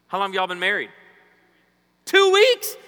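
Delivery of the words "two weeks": high, loud surprised call-out; excited announcer call. Real, not acted. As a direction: shout it out, amazed, pitch way up. Calling out to newlywed couple in crowd.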